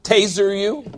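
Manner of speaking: normal talking voice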